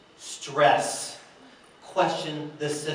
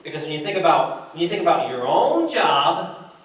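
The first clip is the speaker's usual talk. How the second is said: ordinary delivery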